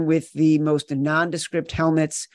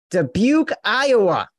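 mocking disbelief; 7 semitones higher, 4 dB louder than usual. Host repeats town name, mocking.

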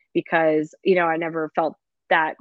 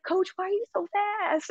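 put-on high, light kid voice; imitating the girls; 4 dB quieter than usual. Players quoted asking their coach, high childlike voice. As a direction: mimic a young player in awe. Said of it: "they're like".

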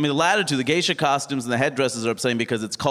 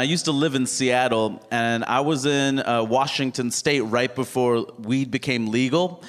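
normal talking voice

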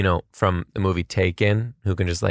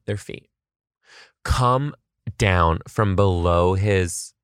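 usual speaking voice

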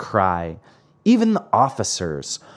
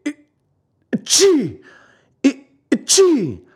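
high, explosive mock sneeze; two big storybook achoos; loud sneezes; 4 dB louder than usual. A narrator's reading. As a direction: build up and burst into a sneeze. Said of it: "Two loud sneezes finished his speech".

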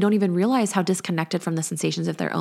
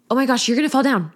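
fast, louder, higher panicked warning; alarmed mock warning; 6 semitones higher, 4 dB louder, faster than usual. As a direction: throw fear at the kid, quickly. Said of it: "trying to scare them".

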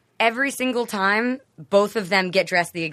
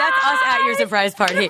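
surprise party shout. Pretend.

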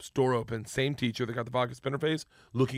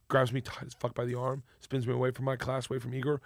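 ordinary talk, nothing marked

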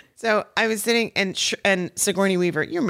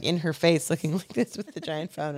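usual speaking voice

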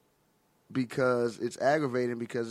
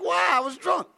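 amused self-defense; 14 semitones higher, 4 dB louder than usual. Retold or imitated. Acted reply amid laughter.

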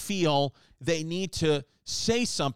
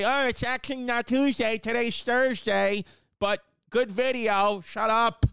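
mock commenter voice; 5 semitones higher, slower than usual. Mocking a pedantic commenter.

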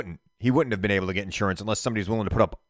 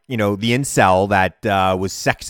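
ordinary talk, nothing marked

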